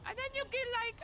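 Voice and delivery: high squeaky voice from the back of the throat; Mickey Mouse voice. Shown as a demonstration. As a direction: explain it in the Mickey voice. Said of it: "You have to put it".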